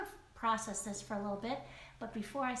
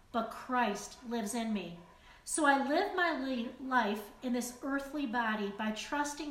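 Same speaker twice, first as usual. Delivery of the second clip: slow, louder, deliberate scripture reading; weighty Bible reading, every word given room; 4 dB louder than usual. Acted. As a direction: read each verse out like a proclamation. Reads Galatians verses aloud.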